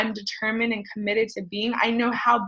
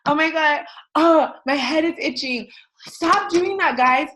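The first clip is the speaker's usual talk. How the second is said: higher-pitched whiny mimicry of a restless kid; mock fidgety protest, pitched up; squirmy exasperated child; 6 semitones higher, 3 dB louder than usual. Eleven-year-old who can't sit through a staring game, exasperated.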